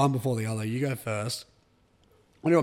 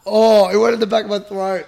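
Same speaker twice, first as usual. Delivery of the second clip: loud; 6 semitones higher, 9 dB louder than usual. Real, not acted. Soap reaching throat.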